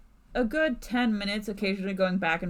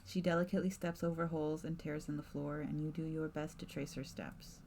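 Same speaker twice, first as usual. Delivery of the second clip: measured narrator voice; story narration; 3 semitones lower, 10 dB quieter than usual. A narrator's reading. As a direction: set the scene like a storyteller. GM narrates scene.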